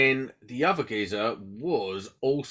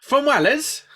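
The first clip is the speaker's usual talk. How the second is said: high squeaky mock surprise on "From Wales?"; 13 semitones higher, 5 dB louder than usual. Real, not acted. Teasing the Welsh guest about his accent, high mock surprise. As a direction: pitch it way up, teasing.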